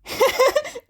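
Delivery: a sudden high burst of giggling; high giggle. Acted. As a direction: giggle high and bright, caught off guard. Izuku laughing at the teasing.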